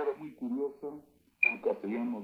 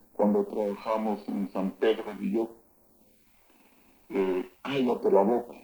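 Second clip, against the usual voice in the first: ordinary delivery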